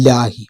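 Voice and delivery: ലാഹി with a light, thin ലാ; ലാഹി not made heavy; correct Arabic reading. Shown as a demonstration. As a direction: model ലാഹി softly and low. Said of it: "ലാഹി എന്നാണ് വായിക്കേണ്ടത്".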